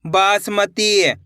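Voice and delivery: Basmati very slow, baa-s-ma-teee; sounded out slowly. Shown as a demonstration. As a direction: stretch every part of basmati. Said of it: "एक अक्षर का उच्चारण करके".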